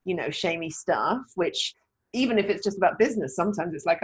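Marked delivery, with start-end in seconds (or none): none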